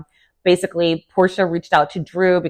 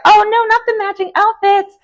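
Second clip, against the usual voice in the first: high, squealing mock dismay; 4 dB louder than usual. Cast groans at the outfit gossip, high mock dismay. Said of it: "Everyone's like".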